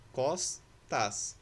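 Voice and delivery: "Costas" broken as cos, tas; split into syllables. Shown as a demonstration. Teacher showing S separated from T, "Costas" syllable by syllable. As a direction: pause between the two syllables of "Costas". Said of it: "O S separado do T".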